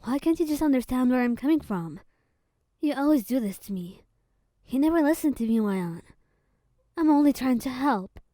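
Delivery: loud whispered argument, hurt and exasperated; angry outburst. Acted. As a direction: let the frustration burst out at your partner. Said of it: "Venti snapped".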